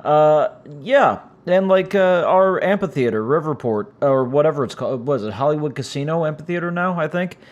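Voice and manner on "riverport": ordinary talk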